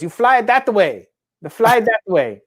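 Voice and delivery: high-pitched imitation of an old woman; old lady. Old lady giving a helicopter pilot directions, high-pitched imitation. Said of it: "The old lady goes".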